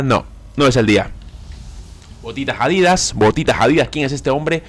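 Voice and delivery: normal talking voice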